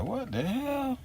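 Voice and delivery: high incredulous what the hell; baffled. Retold or imitated. Reacting to the crying clerk, high baffled. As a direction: toss it up in disbelief.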